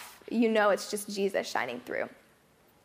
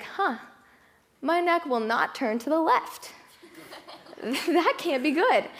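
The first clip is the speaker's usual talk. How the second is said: puzzled huh; 6 semitones higher than usual. Retold or imitated. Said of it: "I was like".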